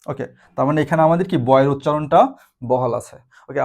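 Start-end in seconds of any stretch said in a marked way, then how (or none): none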